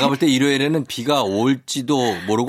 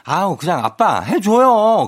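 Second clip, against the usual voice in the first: whiny daughter; 5 semitones higher, 4 dB louder, slower than usual. Retold or imitated. Daughter whining to father.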